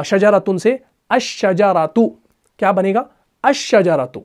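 "अशजरातु" with doubled shin, lam silent, slow; slow model reading; sun-letter demo. Shown as a demonstration. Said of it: "लाम को नहीं पढ़ा".

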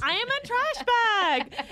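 high, loud, drawn-out declaration; hammed-up slow shout; mock proclamation. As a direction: proclaim it slowly at full comic volume. Joining the mock stereotype bit.

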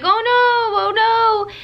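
high, loud mock moan of panic; a hammed-up panicked husband moaning; imitating husband moaning. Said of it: "like, moaning".

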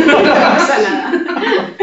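laughing through the words; giggling while reassuring. Real, not acted. Laughing at the murcianica joke.

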